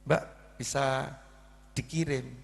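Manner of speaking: soft, unsure asking; hesitant request. Retold or imitated. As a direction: ask politely, half expecting a no. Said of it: "nada bicara orang kebanyakan mikir". (bertanya pelan dan ragu-ragu)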